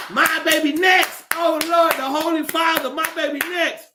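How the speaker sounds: mock the parents lining up for the Pope; hammed-up parents begging their baby is next; eager churchgoers. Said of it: "people just standing in line like".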